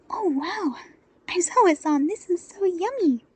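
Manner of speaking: put-on high, bright child's squeal of delight; a small kid thrilled by a treat; high-pitched, delighted child voice; excited little girl. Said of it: "the little brunette smiled".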